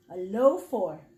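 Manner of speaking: usual speaking voice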